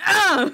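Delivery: high, loud grunting yell; a hammed-up grunt rounding a corner; mock tennis grunt. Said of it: "letting out, like, tennis grunts".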